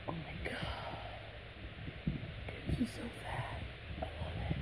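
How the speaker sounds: barely audible, breath-only whisper; awed whisper. Real, not acted. Collector first seeing her doll's face, awed whisper. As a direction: whisper in overwhelmed awe.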